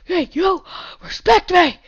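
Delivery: high loud demand for respect; mock shout. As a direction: yell it like a tough guy. Shouting at a mob.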